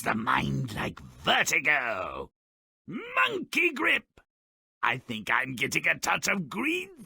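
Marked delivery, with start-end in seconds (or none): 2.9-4.1 high, slow strained exclaim; straining. Acted. Jack gripping the beanstalk, high strained exclaim. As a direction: hang on for dear life. Jack clinging while climbing.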